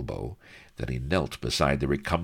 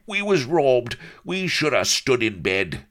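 high, loud pirate complaint; bawling; 11 semitones higher, 7 dB louder than usual. A narrator's reading. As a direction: bellow it like a cheated rogue. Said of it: "bawled Firebeard".

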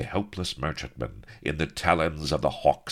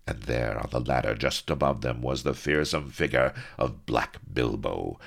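ordinary delivery, nothing marked

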